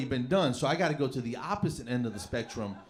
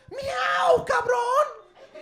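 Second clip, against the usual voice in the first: very high, loud cat yowl; shrill feline battle cry; Catman warning call; 5 dB louder, much slower than usual. Acted. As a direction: screech it like an attacking cat. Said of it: "give out my Catman warning call".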